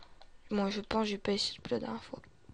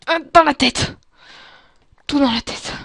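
loud, high-pitched battle yell; combat shout; 4 semitones higher, 15 dB louder than usual. Real, not acted. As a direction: shout it while firing at the head. Shooting zombies in the head.

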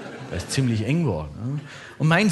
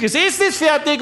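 high mock call through the house; wife yelling from kitchen; 10 semitones higher than usual. Wife calls family to dinner from kitchen, high yell. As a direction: holler it high, as if from another room. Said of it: "schreit die Frau aus der Küche".

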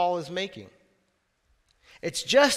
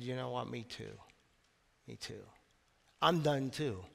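hushed confession; 8 semitones lower, 11 dB quieter than usual. Retold or imitated. Church members confess, moved.

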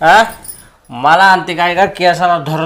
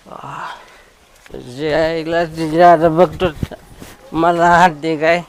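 usual speaking voice

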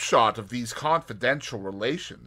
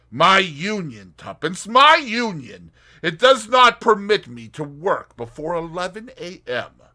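Tommy voice; 8 semitones higher, 11 dB louder than usual. Acted. Tommy joking lazily.

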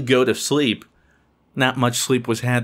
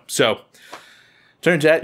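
ordinary delivery

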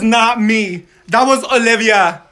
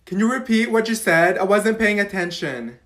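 normal talking voice

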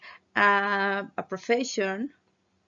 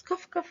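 cough, cough as little coughing sounds; imitated cough; 9 semitones higher, 5 dB quieter than usual. Shown as a demonstration. Teacher imitating a cough for the class. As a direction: fake a small cough on cough, cough. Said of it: "como cuando tosemos".

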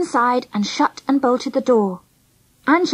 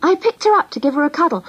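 plain reading voice

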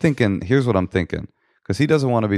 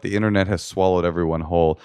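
ordinary talk, nothing marked

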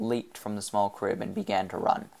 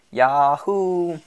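loud, high cowboy yahoo; whooping; 7 semitones higher, 8 dB louder than usual. A narrator's reading. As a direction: let out a long festive whoop. Citizen whoops at the platform.

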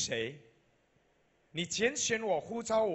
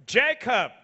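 loud, high preaching cry building to the names; preaching at full force; 7 semitones higher, 9 dB louder than usual. Acted. Pastor booming to the congregation, full-force preaching. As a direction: proclaim it to the whole hall.

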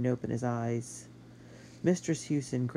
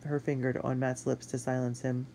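ordinary delivery, nothing marked

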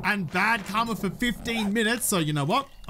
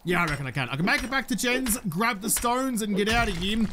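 normal talking voice

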